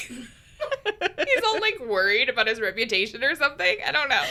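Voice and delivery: loud, high laughing start; talking through laughter. Real, not acted. Cohost joking about his worried reputation, laughing. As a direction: let real laughter spill into the words.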